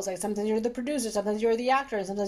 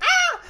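loud, high-pitched mock cry; loud high wail; 9 dB louder than usual. Doctor retelling reaction to manager's kindness, loud mock wail. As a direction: let out an exaggerated "ah".

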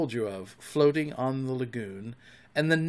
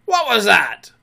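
high, loud startled demand; sharp, raised cry; 8 semitones higher, 10 dB louder than usual. A narrator's reading. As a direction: call out in alarm.

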